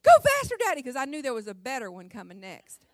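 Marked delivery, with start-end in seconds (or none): 0.0-0.8 put-on high, loud gleeful kid yelling go faster; little girl begging her dad to speed up, shrieking with glee; excited child's cry. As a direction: shout it like a thrilled four-year-old. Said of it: "my arms on the front seat saying".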